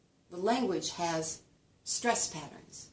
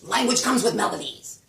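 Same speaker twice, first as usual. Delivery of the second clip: loud, higher, all in one go; forceful burst; 5 semitones higher, 11 dB louder than usual. Shown as a demonstration. Lecturer showing another melody of language, forceful. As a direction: belt it out without a pause. Same phrase again, forceful contrast.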